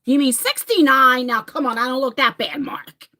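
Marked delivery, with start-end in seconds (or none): none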